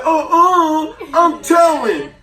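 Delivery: high, loud whiny tattletale; tattling kid. Retold or imitated. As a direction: threaten to tell mom in a shrill child voice. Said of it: "He said".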